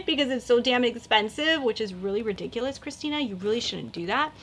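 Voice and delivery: normal talking voice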